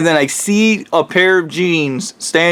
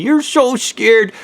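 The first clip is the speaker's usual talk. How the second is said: mocking tease; 7 semitones higher, slower than usual. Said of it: "he kept making fun of me".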